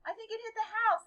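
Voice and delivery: acting out a worried dad on the phone; nervous dad. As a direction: retell it with rising alarm. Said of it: "He was really nervous sounding on the phone".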